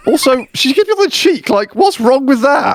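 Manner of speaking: defensive. Real, not acted. Mock defensive.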